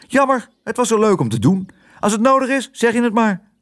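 hoog vogelstemmetje; musje Toep. Acted. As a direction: praat piepend hoog, als een mus. (hoog mussenstemmetje)

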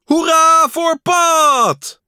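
very high, loud, drawn-out cheer; slow high-pitched hurrah at full shout. A narrator's reading. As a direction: cheer shrilly from the audience. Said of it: "en hij schreeuwde".

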